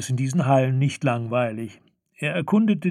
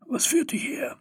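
put-on high breathy whisper of an old gnome; 13 semitones higher, 4 dB quieter than usual. (geflüsterte Gnomstimme)

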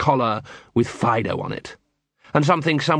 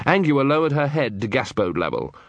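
ordinary delivery, nothing marked

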